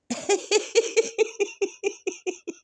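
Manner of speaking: high laugh breaking out; real burst of laughter. Real, not acted. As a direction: let a high laugh escape. Laughing mid-sentence. (ضحكة عالية حقيقية)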